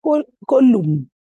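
"column" sounded out slowly; careful pronunciation. Shown as a demonstration. Speaker unsure how they pronounce it, careful slow "column". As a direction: try out "column" slowly, after a false start. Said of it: "How do they pronounce it?".

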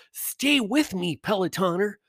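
high, slow, syrupy mock instructor; sing-song parody of a fitness coach. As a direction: ham up the cheesy trainer's encouragement. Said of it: "When I do this voice, it means I'm mocking them".

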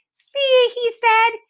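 put-on very high, loud little-kid voice; toddler Fudge. A narrator's reading. Little Fudge shows off his haircut to Peter, high and chirpy.

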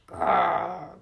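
a menacing three-headed dog growl. Acted. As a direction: growl like a huge guard dog. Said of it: "making a new kind of growl".

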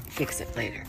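soft, low muttered reminder; quiet self-talk. Real, not acted. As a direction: murmur it to yourself. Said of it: "Blue. It's fine".

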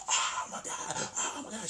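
high, breathy panicked gasps; mock panic. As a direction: imitate a girl freaking out. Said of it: "She's freaking out".

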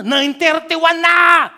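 slow, loud, hammed-up yell; mock shout. Neighbor scolding over one minute late, loud mock shout. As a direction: yell the time like a petty neighbor.

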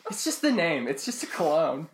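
normal talking voice

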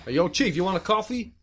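put-on New York accent. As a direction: offer it as a chummy New York cop. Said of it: "So you're New York".

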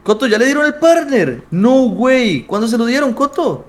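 calling out with real delight; excited calling out. Real, not acted. Streamer congratulating his friend Coto, excited and loud.

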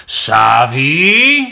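loud, high, drawn-out name call; angry mum's summoning yell; yelling from another room. A narrator's reading. Mum calling Savi from the bathroom, yelling. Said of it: "Mor råbte inde fra badeværelset".

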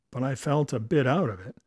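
ordinary delivery

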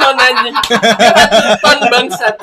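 laughing loudly; bursting out laughing. Real, not acted. Breaks into laughter.

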